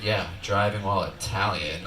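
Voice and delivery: low, slow mock cop; deadpan cop. Cop jokes to the driver, low and deadpan. Cop's punchline.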